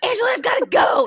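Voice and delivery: high, loud crying shout. Retold or imitated. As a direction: wail it like a horror heroine. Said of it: "I was [name] screaming to go get the cigs".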